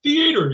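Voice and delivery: "Theater" said thee-AY-ter, stress on the second syllable; mock-proper pronunciation. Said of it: "you gotta say it properly".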